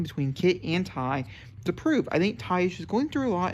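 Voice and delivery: ordinary talk, nothing marked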